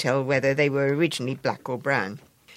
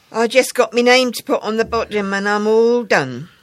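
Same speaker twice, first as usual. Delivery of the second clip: put-on a boy's higher, louder, pleased voice; boasting he's nearly finished; proud schoolboy; 6 semitones higher, 8 dB louder than usual. Boy proud of his sampler.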